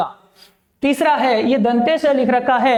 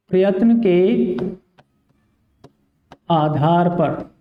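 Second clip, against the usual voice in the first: slow teacher emphasis; 5 semitones lower, slower than usual. Shown as a demonstration. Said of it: "किसके आधार पर विद्यार्थियों?".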